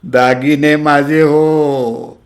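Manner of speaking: slow, high, drawn-out wail; childish wail. Acted. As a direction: bawl it like a child. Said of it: "लहान मुलासारखं भोकाडच पसरला".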